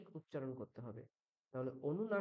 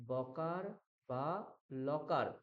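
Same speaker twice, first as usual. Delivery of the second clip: slow; much slower than usual. Shown as a demonstration. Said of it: "খেয়াল রাখুন সবাই".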